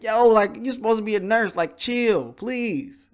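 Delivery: high, loud exasperated plea to the nurse; yo, chill, said loud and high like a fed-up husband; retold exasperated yell. Husband telling the rough nurse to chill, loud and exasperated. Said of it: "I'm like".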